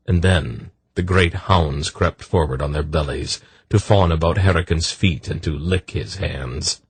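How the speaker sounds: usual speaking voice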